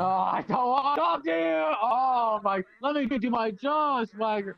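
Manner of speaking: angry outburst. Acted. Fed-up greeter yelling at a pestering visitor, angry. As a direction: yell him off your doorstep.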